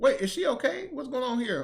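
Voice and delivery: high, loud burst of alarm; startled concern. Real, not acted. Reactor breaks off over the woman in the clip, startled concern.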